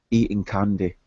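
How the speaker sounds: ordinary talk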